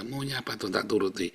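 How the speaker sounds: hushed, submissive prayer; resigned surrender. Shown as a demonstration. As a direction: give in softly to God. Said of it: "Pasrah, saudara". (doa lirih penuh kepasrahan)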